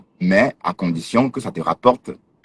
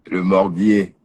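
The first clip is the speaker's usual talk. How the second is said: ordinary delivery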